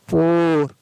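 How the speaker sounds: पोर with a long, held "oh". Shown as a demonstration. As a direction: draw out the vowel ओ in पोर. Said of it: "ओ लंबा पड़ा जाता है".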